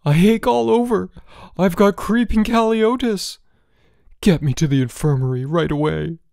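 high, loud wail of alarm; gasping panic. A narrator's reading. George begging for the infirmary, panicked. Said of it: "he gasped".

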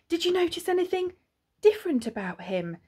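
quick, high, eager question; said quickly. A narrator's reading. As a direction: rush it out urgently, starting high. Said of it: "said Ma quickly".

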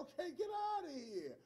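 high, loud brush-off; mock dismissal. Harvard dismissing Cornel West, high and mocking. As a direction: wave him off, scornful.

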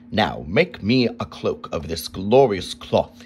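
put-on king ordering the boy to make a cloak. A narrator's reading. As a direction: command it like a greedy king. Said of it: "he ordered".

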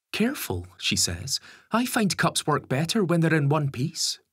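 dry teasing woman. A narrator's reading. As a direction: tease him dryly. Lisa teases Gary.

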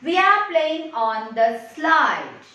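slow, measured read-aloud for learners; model reading. Shown as a demonstration. Teacher reading once for the children, slow model reading. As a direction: read the sentence out slowly and deliberately. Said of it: "Once I will read for you".

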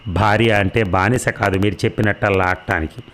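plain reading voice

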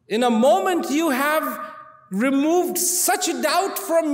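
high, slow, heartfelt thanks; king on his knees thanking the wise man; grateful king. Acted. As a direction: pour out thanks slowly, fading at the end.

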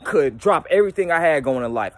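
usual speaking voice

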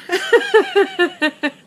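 long, high burst of laughter; tearful laughing. Real, not acted. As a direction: laugh high and helplessly through tears. Laughing at own red face.

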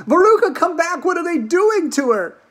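frantic mother's high, loud cries; screamed. Acted. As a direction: call after your daughter in alarm, screaming. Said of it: "screamed Missus Salt".

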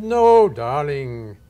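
slow, loud, drawn-out "No, darling" in a grand teacher voice; a hammed-up imperious mentor; imitating the teacher. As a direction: stretch it out like a grand old teacher letting him down. Quoting the teacher's refusal.

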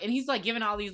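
normal talking voice

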